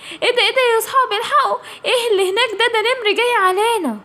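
high, rushed cry of warning; sudden alarm. Acted. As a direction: blurt out the danger in a panic. Kids spot a tiger. (صرخة تحذير سريعة ومفزوعة)

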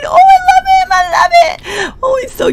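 very high, loud gushing squeal. Real, not acted. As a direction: squeal with delight. Gushing over cute Sim.

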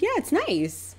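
high-pitched, noncommittal sweetness; high, polite reassurance; polite placating. Retold or imitated. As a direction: agree pleasantly in a high voice. Placating the insulted client.

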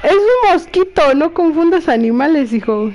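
high-pitched exasperated correction; scolding her son. Real, not acted. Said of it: "si me oyen peleando con".

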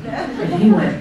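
ordinary talk, nothing marked

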